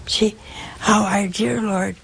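ordinary talk, nothing marked

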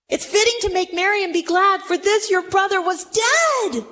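impassioned father. Acted. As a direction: insist joyfully to a son. Father justifies the celebration, acted.

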